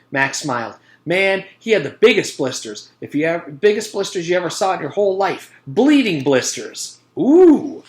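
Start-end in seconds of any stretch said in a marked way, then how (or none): 1.1-7.0 gleeful storytelling. A narrator's reading. Mac spins gory tale. 7.2-7.8 impressed ooh. A narrator's reading. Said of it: "said Joe and John together".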